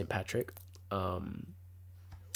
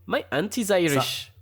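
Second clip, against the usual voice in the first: higher-pitched mock Irish lilt; amateur Irish accent; 5 semitones higher than usual. Shown as a demonstration. Joking demo of how her Irish auntie speaks, bad Irish accent. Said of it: "I'm probably doing it bad".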